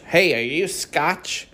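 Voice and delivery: mocking American. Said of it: "No, they're not Scotch".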